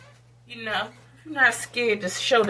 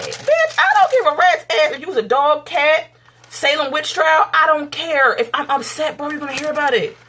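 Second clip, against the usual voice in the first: loud, raised-pitch angry defiance; defiant outburst; 5 semitones higher, 7 dB louder than usual. Acted. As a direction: throw it back at them at full volume. Defiant retort to imagined objectors.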